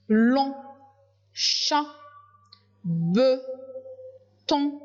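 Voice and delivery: chaque syllabe détachée avec une pause; lecture syllabe par syllabe. Shown as a demonstration. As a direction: lis les syllabes une à une. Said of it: "se prononcent va". (syllabes séparées, lentement)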